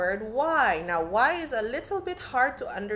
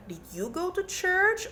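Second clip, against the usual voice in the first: pitched way up on church, rising; high rising question; 9 semitones higher than usual. Shown as a demonstration. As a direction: ask it brightly, voice climbing on church. Teacher voicing asking sentences.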